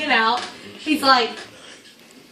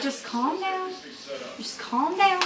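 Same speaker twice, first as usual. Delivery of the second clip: soft, raised-pitch calming voice; imitated soothing; 6 semitones higher, 8 dB quieter, slower than usual. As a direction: gently talk down a panicking driver, as the cop. Cop soothing a panicking driver.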